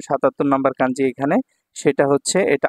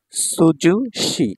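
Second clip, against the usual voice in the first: slow drill; 3 semitones higher, much slower than usual. Shown as a demonstration. Teacher assembling the word slowly.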